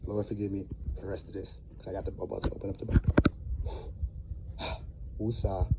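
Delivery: low, soft, contrite muttering; calm yourself down after an outburst; quietly asking forgiveness with a sigh; subdued apology. Real, not acted. Composing himself after an angry rant.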